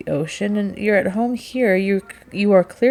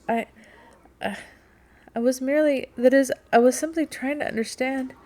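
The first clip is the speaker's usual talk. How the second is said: higher voice, broken by pauses; halting, difficult speech; 7 semitones higher than usual. A narrator's reading. Rachel defends herself after the captain's outburst, halting and flustered. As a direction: stammer out an explanation, restarting. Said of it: "the words came with difficulty".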